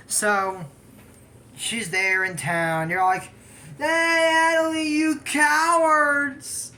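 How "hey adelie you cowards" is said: high, loud jeering call; loud high crowd taunt; taunting yell. Retold or imitated. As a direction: jeer across the crowd, drawn out. Crowd taunting the rival family.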